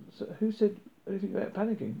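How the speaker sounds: a touch of indignant snapping; snapped. A narrator's reading. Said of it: "snapped Arthur".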